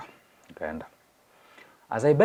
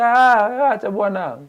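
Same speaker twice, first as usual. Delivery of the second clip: dismissive wife; 13 semitones higher, 8 dB louder, slower than usual. Retold or imitated. Wife dismisses him angrily.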